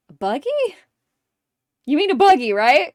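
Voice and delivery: high-pitched puzzled query, rising; puzzled questioning. Retold or imitated. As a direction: quote them asking, baffled. Acted baffled women quote.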